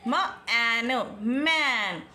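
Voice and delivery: drawn-out sounding of मैन; slow phonics. Shown as a demonstration. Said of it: "इस तरह से बच्चा राइट करेगा मैन".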